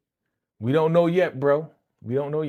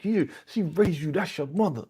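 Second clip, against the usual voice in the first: higher-pitched mock scolding; imitated telling-off; 7 semitones higher than usual. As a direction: drive it home as the exasperated wife. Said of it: "she was going in on him. She was like".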